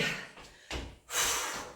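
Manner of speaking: sharp breath out through the lips; blowing out hard. Real, not acted. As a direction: blow out hard on the effort. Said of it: "Tu m'entends souffler?".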